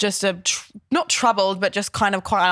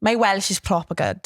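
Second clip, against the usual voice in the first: lilting Welsh put-on voice; Welsh accent. Shown as a demonstration. Co-host asked for Welsh, so a proud Welsh accent. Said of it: "Go and do your Welsh".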